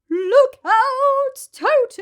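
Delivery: very high, loud warning cry; hippo crying out. A narrator's reading. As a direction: shout the warning to the children. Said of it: "cried Humpy Rumpy".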